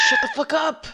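loud, high irritated shout; fed-up yell at a friend; exasperated shout. Acted. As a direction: shut them up sharply. Snaps at the fanfare gag.

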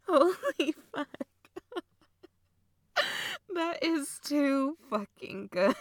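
loud, high, cracking up; delighted laughter. Acted. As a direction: gasp out praise between fits of laughter. Cracking up at brother's impression.

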